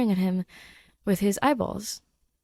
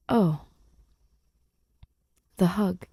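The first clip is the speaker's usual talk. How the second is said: plain reading voice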